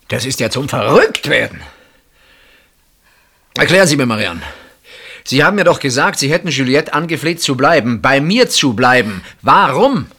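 high, loud, exasperated questioning; agitated demand. Acted. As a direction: press her hard, losing patience. Man confronts her, agitated. (aufgebrachtes, lautes Fordern)

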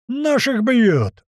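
very slow, high, loud yell. Acted. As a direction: scream it as a rallying cry. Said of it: "орёт мамуля".

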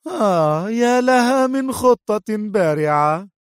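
slow, high sarcastic drawl; mock his plan slowly. A narrator's reading. Sonia mocking Marcel's plan, a slow sarcastic drawl. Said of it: "غمغمت سونيا في سخرية".

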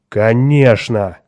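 loud, slow, drawn-out angry retort; irritated captain, loud and emphatic. A narrator's reading. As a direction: stretch it out with angry force. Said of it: "сердито сказал капитан".

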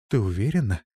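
plain reading voice

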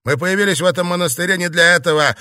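loud, high-pitched angry retort; heated, insistent protest; shouting angrily. A narrator's reading. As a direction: raise your voice in irritation. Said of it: "«Хватит кричать»".

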